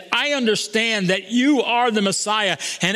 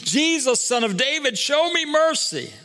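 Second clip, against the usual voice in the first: higher pleading cry, not much louder; mock scream; 8 semitones higher than usual. Blind beggar calling to Jesus, a higher mock plea. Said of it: "screamed even louder".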